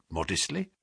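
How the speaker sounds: ordinary delivery, nothing marked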